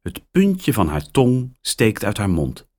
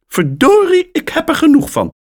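very high, loud and fast; furious caller; 7 dB louder, faster than usual. A narrator's reading. Fed-up caller before hanging up, loud and fast. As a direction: explode in frustration.

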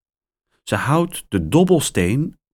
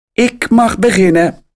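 higher, louder, delighted; happy; 11 semitones higher, 6 dB louder than usual. A narrator's reading. Mia gets to start the game, gleeful and louder. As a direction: crow it with joy. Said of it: "zegt ze blij".

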